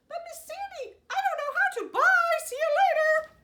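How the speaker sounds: high, loud panicky rooster; a flustered little bird begging; squeaky rooster. Acted.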